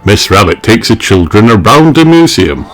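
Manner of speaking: plain reading voice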